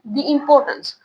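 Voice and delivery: "दी" with long ee before importance; "the" as dee. Shown as a demonstration. Teacher repeats the answer for pupils, "दी" as dee. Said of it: "क्या आएगा बच्चे?".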